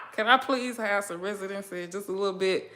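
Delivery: usual speaking voice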